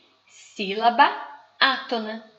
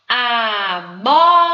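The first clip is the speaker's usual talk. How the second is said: "Amora" drawn out loudly, heavy long MO; stress on MO; 10 dB louder, much slower than usual. Shown as a demonstration. Teacher demonstrates stressed syllable to class, loud, drawn-out "Amora" with strong MO. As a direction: stretch "Amora" syllable by syllable, leaning hard on MO. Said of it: "é a sílaba que tem mais intensidade".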